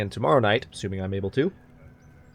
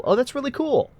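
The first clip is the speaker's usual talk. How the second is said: surprised; 10 semitones higher than usual. Real, not acted. Surprised by queen model.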